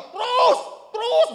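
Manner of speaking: loud, high-pitched yell; imitated parking shouts. Loud parking attendant guiding cars outside, imitated bellow. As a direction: holler like a parking attendant directing a car. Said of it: "Kenceng banget".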